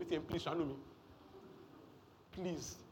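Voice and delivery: mock begging the ATM. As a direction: beg a machine for mercy, lightly acted. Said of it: "You're begging the bank".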